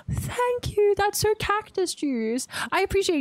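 high, slow, delighted gush; gushing thanks. Real, not acted. Streamer thanks a viewer after pausing, high gushing voice.

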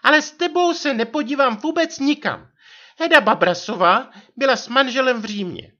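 very high, loud nagging wife voice; vyčítavá manželka. A narrator's reading. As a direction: reproach him in a put-on high wife's voice. Narrator voices reproachful wife. (vysoký vyčítavý hlas manželky)

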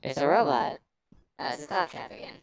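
processed robotic voice filter. Acted. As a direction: talk through a robot effect. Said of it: "sounds more like a robot".

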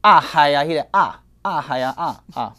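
loud open 啊 sound; open ah vowel demo. Shown as a demonstration. Teacher demonstrates the 啊 vowel loudly. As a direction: sing out 啊 with open throat. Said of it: "有一个声音叫做啊".

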